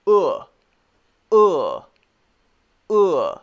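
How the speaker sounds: a short punched "uh" from the back of the throat; teacher demonstrating the book vowel; short oo sound. Shown as a demonstration. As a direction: push the jaw out and bark a short "uh". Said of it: "a special sound, which is like this".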